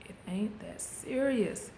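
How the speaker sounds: slow, drawn-out words. Retold or imitated. Calming herself before the gym, slow and drawn out. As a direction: stretch each word out slowly. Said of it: "And be like".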